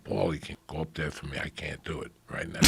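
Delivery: hushed, fast whisper; anxious whisper. Retold or imitated. Begging Paulie Shore to go on for him, whispered. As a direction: plead under your breath, backstage. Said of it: "reaching over to Paulie Shore and going".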